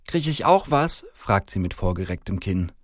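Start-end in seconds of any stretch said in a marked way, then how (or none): 0.0-1.0 put-on high, slow little-girl question. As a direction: ask hopefully like a small child. Little girl asks Santa for gift.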